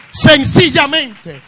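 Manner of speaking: loud, high shouted preaching; fiery revival preacher shouting the promise; preaching at full force. Acted. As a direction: proclaim it to the whole church.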